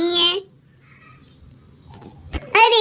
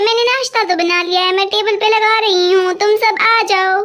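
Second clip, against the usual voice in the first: ordinary talk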